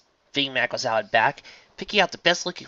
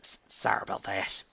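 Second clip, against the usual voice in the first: soft, embarrassed stammer; sheepish apology; 6 dB quieter than usual. A narrator's reading. Farm pony catching herself before her friend, sheepish. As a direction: shrink back and apologize quietly. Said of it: "blushed and looked down".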